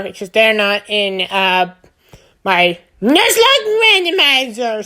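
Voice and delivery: ordinary delivery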